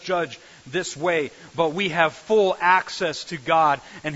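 loud, driving sermon delivery; forceful preaching. Acted. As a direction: press the congregation with full conviction. Preaching climax.

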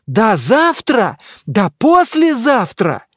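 loud, high, drawn-out exclamation; indignant cry. A narrator's reading. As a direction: cry it out sarcastically, stretching each word. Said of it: "вскричал монах".

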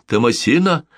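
high, coaxing call to a cat. Acted. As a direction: lure the cat with a treat. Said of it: "позвала миссис Маккензи".

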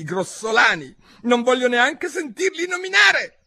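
high, squeaky mouse voice, indignant; a trembling mouse full of outrage; offended mouse. A narrator's reading. The mouse ranting to Alice about cats.

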